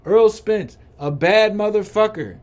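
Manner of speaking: Errol Spence punched out loud and high, every word hammered; emphatic. Acted. Hyping up the boxer to his viewers, loud and emphatic. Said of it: "I'm gonna emphasize it".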